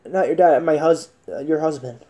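usual speaking voice

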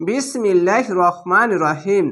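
slow, drawn-out recitation; Quran recitation. Shown as a demonstration. Teacher opening a Quran class, slow chanting. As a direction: intone it slowly and with reverence.

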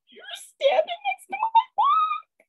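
very high, squeaky and thrilled; giddy excitement. Retold or imitated. Author telling the stranger at the counter, very high and giddy. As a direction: blurt it to the stranger, barely containing yourself. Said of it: "I just couldn't contain my excitement".

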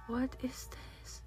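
baffled whisper. Real, not acted. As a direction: breathe it out, puzzled. Confused by new update.

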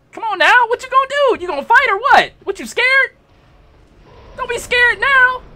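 high, loud, goading challenge; cocky high-pitched trash talk; taunting. Real, not acted. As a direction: dare the opponent to come and fight. Taunting boss to fight.

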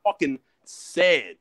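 ordinary talk

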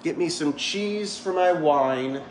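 slow, drawn-out melodramatic whine; theatrical woe, stretched out; mock self-pity. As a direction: ham up the poor-me lament. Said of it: "ready for this?".

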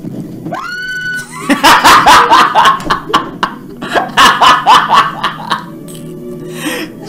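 long high shrieking laugh; shocked laughter. Real, not acted. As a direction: howl with laughter, nearly crying. Laughs hard at video.

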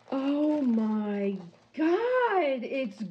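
sharp gasp, then a high, very slow, drawn-out "Oh my God"; awestruck. Real, not acted. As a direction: gasp and melt in wonder. Awe at the finished painting.

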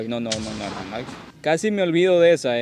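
ordinary talk

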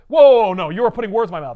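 loud indignant denial. Retold or imitated. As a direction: push back hard and loud. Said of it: "He goes".

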